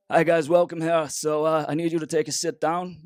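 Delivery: hammed-up Scandinavian accent. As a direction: play up a Scandinavian host's greeting. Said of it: "so this is my best Scandinavian accent".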